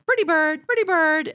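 high squawky parrot imitation. Imitating her late parrot saying pretty bird. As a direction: mimic the pet bird calling out high. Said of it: "always tell me".

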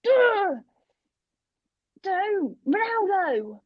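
high-pitched mock exasperation, no real volume; imitated gamer shouting. FIFA player moaning at footballers on screen, mock shout. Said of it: "just shouting out these things".

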